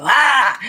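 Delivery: loud high scare yelp; jump-scare shout, way up high; startled scream. Retold or imitated. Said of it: "Somebody would walk by and go".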